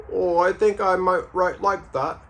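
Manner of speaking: high-pitched put-on Liverpool accent; mock Scouse voice. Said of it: "that's a nice accent you got".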